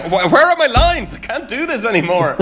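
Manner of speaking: high, loud flustered actor voice; panicked actor. Retold or imitated. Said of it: "David Diggs is like".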